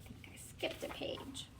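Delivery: soft whisper to herself; whispered aside. Real, not acted. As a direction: murmur it under your breath, off-script.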